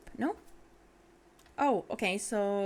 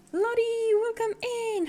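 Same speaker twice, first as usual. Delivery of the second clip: much higher, sing-out welcome; excited greeting; 13 semitones higher, 4 dB louder, slower than usual. Real, not acted. Streamer welcomes Lottie into the stream, high and excited. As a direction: squeal the name with real delight.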